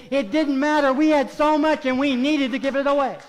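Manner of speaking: loud, high, impassioned pulpit delivery; full-force preaching. Acted. Preacher urging congregation to give love away, full-force preaching.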